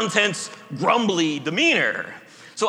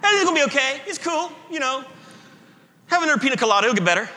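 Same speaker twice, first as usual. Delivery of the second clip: higher, carefree mock comfort; glib, breezy; 5 semitones higher, much faster than usual. Shown as a demonstration. Preacher mocking shallow comfort, carefree and glib. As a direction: brush it off like a beach bar chat. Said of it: "you could be said that way".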